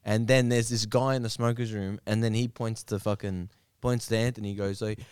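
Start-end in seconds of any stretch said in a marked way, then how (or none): none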